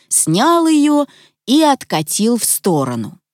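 plain reading voice

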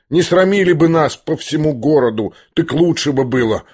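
plain reading voice

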